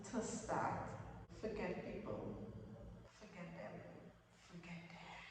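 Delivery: drops to a near-whisper, voiceless; hushed repeated dismissal, barely voiced; fading whisper. Real, not acted. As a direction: let it fade into a hushed aside.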